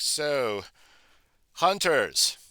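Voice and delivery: slow, formal owl speaking with dignity; a lightly voiced stately owl, slow and courteous.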